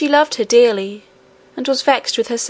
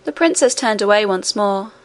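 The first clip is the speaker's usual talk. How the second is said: ordinary delivery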